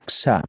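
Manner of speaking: "xa" said as "ksa"; Spanish X. Shown as a demonstration. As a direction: say the X of "xa" as K plus S. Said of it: "si digo en castellano".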